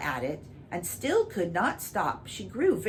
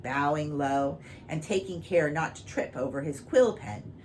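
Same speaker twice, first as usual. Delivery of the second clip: ordinary delivery, nothing marked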